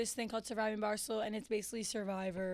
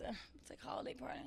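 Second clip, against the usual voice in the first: whispered aside; 12 dB quieter, faster than usual. Real, not acted. Whispering to her co-host about the awkward party. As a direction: drop to a whisper on holiday party.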